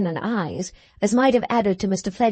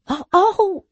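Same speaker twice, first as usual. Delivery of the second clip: high, louder pained groans; 10 semitones higher, 4 dB louder than usual. Acted. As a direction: cry out from the sting. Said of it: "groaned Mister Fletcher".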